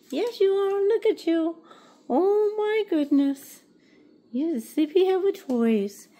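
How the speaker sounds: high, sing-song cooing to a pet. Real, not acted.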